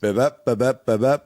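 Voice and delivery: bebep, bebep with a hard beat on each "bep"; rhythm beat. Shown as a demonstration. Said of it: "What is that?".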